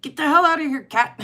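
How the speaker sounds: half-playful cussing at the cat; mock-gruff shooing of the stray cat; cussy shooing. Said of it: "get a little cussy".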